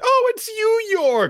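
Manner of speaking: squealing high, loud greeting; light up at an old friend; delighted recognition. Retold or imitated. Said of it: "It's like".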